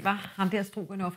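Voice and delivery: plain reading voice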